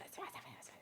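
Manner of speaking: facile, facile, facile breathed out in a bare whisper; sing-song facile dropping to a whisper. Real, not acted. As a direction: whisper the word facile three times, almost soundless. Repeats facile, then fades to whisper.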